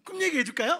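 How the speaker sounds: high and loud, pushy offer; eager little brother. Acted. Joseph pestering his brothers to hear the dream, loud. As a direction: lean in, too keen to share.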